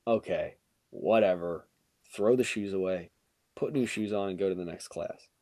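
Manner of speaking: low, soft, unbothered; half-awake resignation. Retold or imitated. Student shrugging it off early morning, half-awake. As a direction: retell it sleepily, dropping the pitch and energy. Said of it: "I'm not really that awake".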